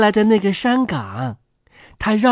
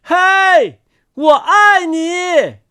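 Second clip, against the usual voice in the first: high, drawn-out hailing shout; loud call; 6 semitones higher, 3 dB louder, much slower than usual. A narrator's reading. Words flying over a mountain, calling loudly. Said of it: "大声说".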